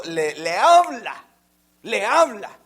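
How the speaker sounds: slow, loud stress on habla; loud, drawn-out habla; emphatic repeat. Acted. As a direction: lean hard into habla. Preacher stresses God speaking.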